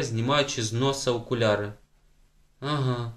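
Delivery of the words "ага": plain reading voice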